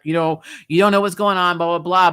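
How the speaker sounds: ordinary talk, nothing marked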